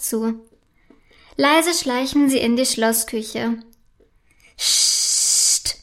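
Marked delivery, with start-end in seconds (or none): 4.6-5.8 long hissed shush. A narrator's reading. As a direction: hush someone with a sustained hiss. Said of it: "macht Prinzessin Felicitas". (langes, zischendes Psst)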